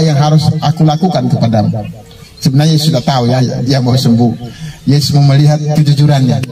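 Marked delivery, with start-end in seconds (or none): none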